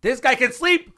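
loud, high excited holler; explosive high-pitched hype; hyped-up shout. Real, not acted. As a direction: shout the punchline in amazement. Hyped punchline after the monologue.

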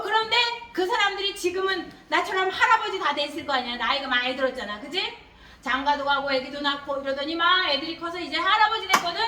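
ordinary talk

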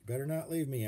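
lightly voiced pleading boy; begging. A narrator's reading. Arnold begging the mother penguin not to leave, mildly.

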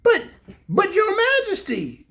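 high, slow stammering protest; protesting exclamation. A narrator's reading. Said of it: "exclaimed Corporal Waddle in protest".

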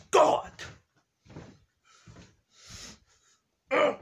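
loud high strangled growls, over and over; growling grunts. Acted. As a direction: growl out angry grunts in bursts. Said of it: "They start just growling out loud".

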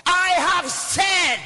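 high, drawn-out shout; booming preacher quoting scripture; thundering declaration. Acted. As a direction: proclaim it slowly at full force. Shouted scripture quote in sermon.

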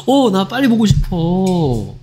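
slow, sweet, drawn-out eagerness; sweet sibling. Acted. Sibling eager to see the portrait, sweet and slow. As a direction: gush sweetly, stretching the words out. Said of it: "되게 스윗하다".

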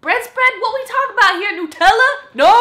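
high, loud incredulous questioning; loud comic self-scolding; mock outrage. Real, not acted. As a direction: pounce on the slip in exaggerated disbelief. Mocking their own slip.

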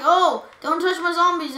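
mock-bossy impression of friend; mean teammate. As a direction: imitate your teammate being mean. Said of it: "I thought you always mean".

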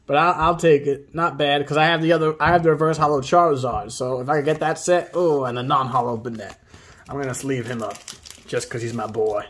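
ordinary talk

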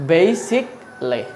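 basically very slow, "BAY-sik ... lay"; British pronunciation. Shown as a demonstration. Teacher answering with the British way, slow "bay-sik-lee". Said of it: "এর British উচ্চারণটা কি?".

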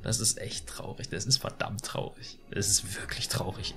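hushed mock-mournful whisper. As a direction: breathe it out, mock despair. Mock grief over bad graphics.

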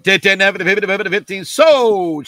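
loud bid-calling chant ending in a called sold; auction cadence. Real, not acted. Auctioneer closing the sale to a buyer, loud chant. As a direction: rattle off the bids, then call it sold. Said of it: "in the middle of the cadence".

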